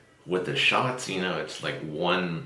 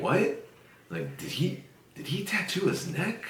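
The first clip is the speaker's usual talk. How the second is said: mock surprise; 12 semitones higher than usual. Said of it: "I was like".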